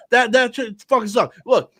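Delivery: ordinary talk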